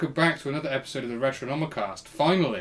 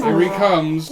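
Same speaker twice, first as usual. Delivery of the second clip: louder and higher, urgent; loud excited shout; 6 semitones higher, 12 dB louder, slower than usual. Pretend. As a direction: bellow it as he arrives. Mock birth climax.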